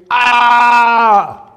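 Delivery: loud, high open-mouthed scream; long, full-voiced yell. A narrator's reading. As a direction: scream "Ah!" like a child throwing a tantrum. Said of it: "and he screamed".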